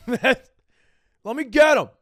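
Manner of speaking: slow and loud, laughing through it; laughing mock yell. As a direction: crack up while yelling to get him. Same plea, laughing, drawn out.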